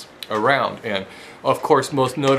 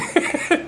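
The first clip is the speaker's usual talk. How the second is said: high breathless laugh. Real, not acted. Laughing at obscene shop image.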